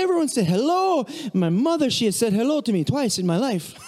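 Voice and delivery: high, hammed-up mock cheerfulness with big smiles; over-exaggerating Frenchman. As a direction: overplay the bewildered French visitor. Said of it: "he was kinda over-exaggerating".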